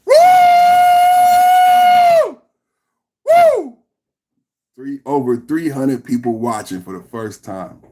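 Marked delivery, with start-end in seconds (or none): 0.1-3.8 loud high cheering whoop; excited whoop. Real, not acted. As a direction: celebrate it with a big yell. Celebrating viewer count.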